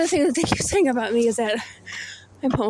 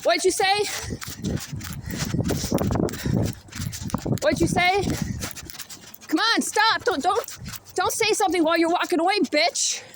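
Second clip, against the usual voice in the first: higher, heated yelling after him; angry taunting; 5 semitones higher than usual. Real, not acted. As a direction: shout after someone walking off. Yelling after a man leaving.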